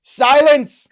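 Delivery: sudden booming Silence! with a laugh; hammed-up villain shout, laughing through it; mock command shout. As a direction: bellow it like a comic villain silencing the room. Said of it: "Don't laugh at me".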